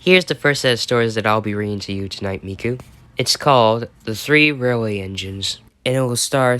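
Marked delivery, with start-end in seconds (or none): none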